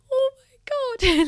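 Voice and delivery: very high, loud, drawn-out amazement; overwhelmed. Retold or imitated. Volunteer reliving a surprise gift of chocolates, overwhelmed. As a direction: squeal it slowly, as if swept away.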